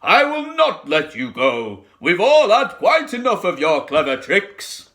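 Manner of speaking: loud, firm, scolding big-animal voice; stern elephant. Acted. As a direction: put the tricky crocodile in his place. Trunky scolds the crocodile.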